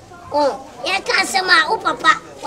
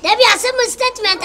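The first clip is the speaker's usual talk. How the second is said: angry protest; 8 semitones higher, 6 dB louder than usual. Acted. Heated argument over the father.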